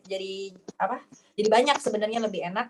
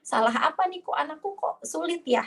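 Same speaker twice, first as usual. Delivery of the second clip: distressed lament; 8 semitones higher than usual. Retold or imitated. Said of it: "gitu untuk menghafal".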